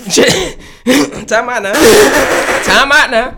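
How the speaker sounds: high, loud words through laughter; laughing. Real, not acted. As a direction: crack up while calling a time out. Cracks up at own joke.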